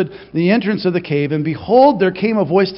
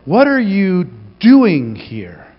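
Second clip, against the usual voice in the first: very slow, deliberate probing; slow searching; 4 dB louder, much slower than usual. Shown as a demonstration. God probing Jonah's purpose, very slow. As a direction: draw each word out, searching.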